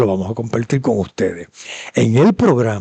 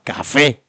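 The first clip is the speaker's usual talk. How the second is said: "café" drawn out slowly toward the accented é; accented final syllable. Shown as a demonstration. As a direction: stretch "café" to show its written accent. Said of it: "te lo ponen con acento".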